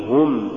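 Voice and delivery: هم with a pure u, as hum; light damma. Shown as a demonstration. Tajweed teacher giving the form to say, light damma. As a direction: keep هم light with rounded u. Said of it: "قل".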